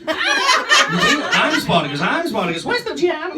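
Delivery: put-on high squeaky kid voice, slow and stretched; a tiny child star shouting the Spartacus line. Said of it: "Kubrick wanted him for Spartacus".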